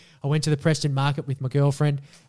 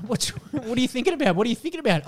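higher pitched, mock curious; curious wife; 8 semitones higher, faster than usual. Wife asking her partner what's on his mind, mock sweet. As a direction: ask sweetly what he's thinking. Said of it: "She's like".

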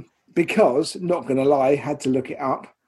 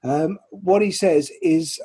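ordinary talk

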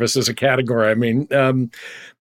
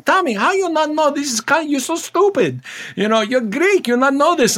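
put-on old Greek church lady; 11 semitones higher than usual. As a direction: scold him like a Greek grandmother.

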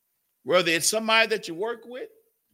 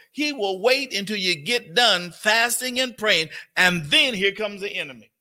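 louder, driving sermon delivery; full-force preaching; 2 semitones higher, 4 dB louder than usual. Acted. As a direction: preach it out to the congregation. Preaching builds toward climax.

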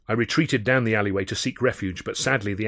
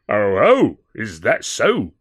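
policeman; 8 dB louder, much slower than usual. A narrator's reading. Constable questioning.

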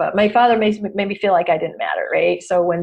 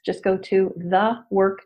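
ordinary delivery

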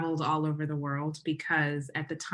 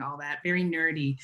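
normal talking voice

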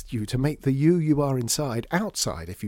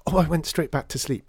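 nervous gulp; 5 semitones higher, 4 dB louder than usual. Acted. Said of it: "he gulped".

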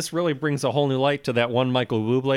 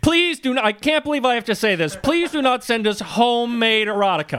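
pleading higher, pressed; exasperated plea; 7 semitones higher than usual. Real, not acted. Cohost begs listeners not to send erotica, exasperated. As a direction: beg them, half laughing, to stop.

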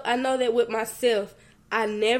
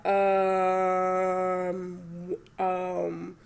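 long, low drawn-out "um"; hesitant stalling; 3 semitones lower than usual. Retold or imitated. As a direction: stall doubtfully, imitating own reluctance. Acted hesitant reply to God.

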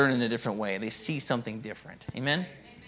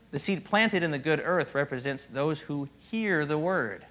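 "planted" lingered on, slow; measured read, "planted" leaned on; slow stress on planted; slower than usual. Shown as a demonstration. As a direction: read it slowly, lean on "planted". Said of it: "if you slow this down and look".

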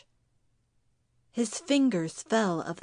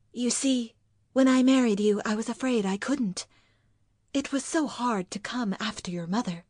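anxious wife; 3 semitones higher, faster than usual. Acted. Wife confesses her fear to husband, anxious.

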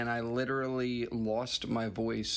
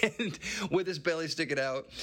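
higher-pitched, said through a laugh; 6 semitones higher than usual. Real, not acted. Storyteller recalling a friend's drunken rant with his belly out, laughing. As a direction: crack up while telling it.

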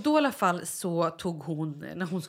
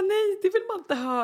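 high, louder mock objection; retold protest; 12 semitones higher, 4 dB louder than usual. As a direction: push back in a much higher pitch. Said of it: "Jag bara". (spelad protest, högt och ljust)

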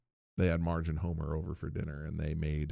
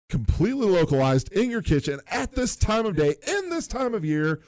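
higher, louder mounting disbelief; incredulous; 10 semitones higher, 5 dB louder than usual. Retold or imitated. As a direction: list each absurd detail in mock outrage. Incredulous boss questioning Skinner.

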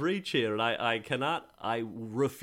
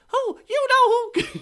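squeaky high Mickey Mouse voice; shrill falsetto girlfriend impression; man doing a woman's voice; 8 dB louder than usual. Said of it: "Do not ever do an impression of my girlfriend".

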